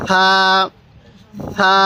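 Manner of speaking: gentle th opening a long সা; soft pronunciation. Shown as a demonstration. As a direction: say সা with a soft, lisped th. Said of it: "সাটা নরম করে".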